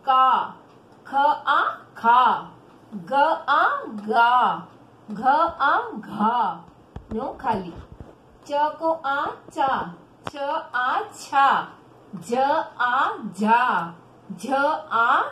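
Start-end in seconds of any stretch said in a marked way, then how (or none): 0.1-6.6 very slow, drawn-out syllables, firmly projected; pronunciation drill. Shown as a demonstration. Teacher drilling children on the barakhadi, slow pronunciation demo. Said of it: "पहले उच्चारण करो". 8.5-15.2 very slow, drawn-out syllables, firmly projected; pronunciation drill. Shown as a demonstration. Teacher drilling children on the barakhadi, slow pronunciation demo. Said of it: "पहले उच्चारण करो".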